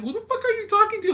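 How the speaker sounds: high, loud imitation of a spooked brother; freaking out. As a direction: shoot up high and loud in panicked disbelief. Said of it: "he was freaking out".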